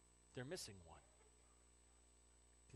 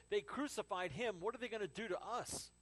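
higher, louder worried plea; acted terror; 6 semitones higher, 5 dB louder than usual. Disciple in a locked room, frightened and agitated.

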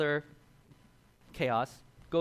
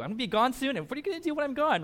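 rising higher and faster lament; exasperated; 10 semitones higher, faster than usual. Retold or imitated. Jesus frustrated with the unbelieving generation, exasperated. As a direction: throw up your hands in frustration.

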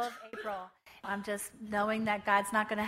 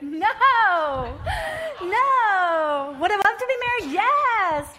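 high, loud exclaiming denial; half-laughing loud protest; loud playful exclaim; 8 semitones higher, 10 dB louder, slower than usual. Retold or imitated. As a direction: cry out no, then yes with gusto. Retold emphatic answer, crying out.

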